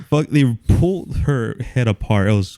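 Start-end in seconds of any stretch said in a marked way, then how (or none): none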